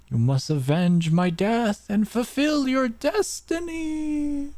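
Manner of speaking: high, slow, booming mystic spirit; overacted death scene. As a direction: play a ghostly mentor, hammed up. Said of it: "This guy again".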